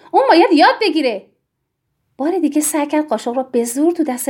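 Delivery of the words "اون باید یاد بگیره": louder, higher retort; heated insistence. A narrator's reading. Tiri overrules Yann about the child, heated insistence. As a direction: insist forcefully in a quarrel.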